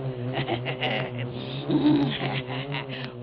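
a long, dark gloating chuckle; low cackle. Acted. Keeper gloating over stung victims, low cackle.

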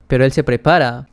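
ordinary delivery, nothing marked

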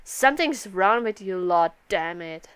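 normal talking voice

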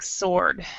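"sword" pronounced "sord", the W dropped; teacher's model of "sword" as "sord"; silent W in sword. Shown as a demonstration. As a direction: demonstrate "sword" without the W sound. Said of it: "say the W part of that".

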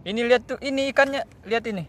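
high, loud and eager; excited. Real, not acted. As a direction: point it out thrilled. Showing fish to friend.

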